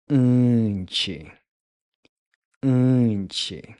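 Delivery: "Unchi" with a nasal vowel and no English N. Shown as a demonstration. Teacher contrasting the Portuguese nasal with English N. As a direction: nasalize "Unchi", ending in "chi". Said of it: "I'm not pronouncing the N like in English. I'm saying".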